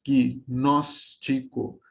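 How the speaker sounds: Gnóstico very slow, gi-nós-ti-co, each beat separate; slow classroom demo of Gnóstico; syllable by syllable. Shown as a demonstration. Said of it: "pensando aqui nessa regra que a sílaba é um impulso de voz".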